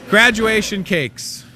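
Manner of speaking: slow, loud, drawn-out promo call; loud announcement. Real, not acted. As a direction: boom it out like an announcer. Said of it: "Did you hear that? That was loud".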